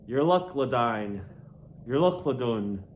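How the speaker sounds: ordinary delivery, nothing marked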